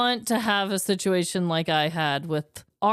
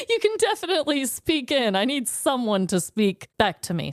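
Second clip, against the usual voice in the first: usual speaking voice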